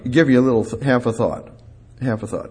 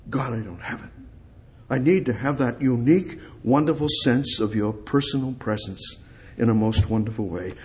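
quiet prayer; 3 semitones lower, 7 dB quieter than usual. Acted. Quiet prayerful plea to God.

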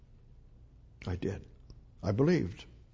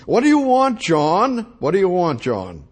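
much higher, loud, gruff demand; rough and annoyed; 4 semitones higher, 7 dB louder than usual. Acted. Company owner at his door confronting the foreman, rough and loud. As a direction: bark at the unwelcome caller. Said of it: "the owner answered it rather roughly".

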